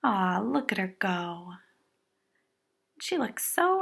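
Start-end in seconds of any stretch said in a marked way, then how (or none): none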